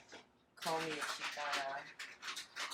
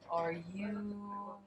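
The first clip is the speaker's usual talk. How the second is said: drawn-out, rising mock question; imitating someone's hesitant guess; tentative guessing; 4 semitones higher, 3 dB quieter, much slower than usual. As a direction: stretch it out as if unsure what to guess. Retold hesitant guess at her nationality.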